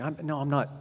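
ordinary delivery

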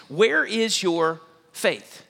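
slow probing preacher question; slow searching question. Acted. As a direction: draw out "where is your faith" very slowly. Rhetorical challenge to congregation.

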